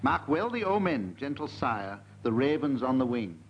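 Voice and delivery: lilting mock-Welsh bardic recital, slow; Welsh accent. Host reading the Welsh poem opening in a mock-Welsh lilt. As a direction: declaim it like a Welsh bard. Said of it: "Yours is a Welsh poem".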